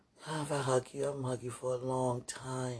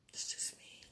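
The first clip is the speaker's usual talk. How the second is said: unvoiced whisper; 12 dB quieter than usual. Real, not acted. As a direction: breathe it out in a whisper. Whispered aside.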